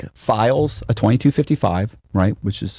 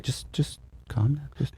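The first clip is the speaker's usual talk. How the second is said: hushed; 4 semitones higher, 13 dB quieter than usual. Retold or imitated. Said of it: "And he's like".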